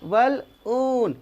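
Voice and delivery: बलून very slow, split as bal - oon; syllable-by-syllable model. Shown as a demonstration. Teacher showing how it is said, slow split demo. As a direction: break बलून in two and stretch the oo. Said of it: "इस तरह कहता है".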